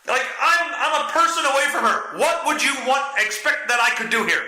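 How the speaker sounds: high-pitched incredulous ranting; exasperated rant. Retold or imitated. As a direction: throw up your hands in disbelief. Ranting at friend in story.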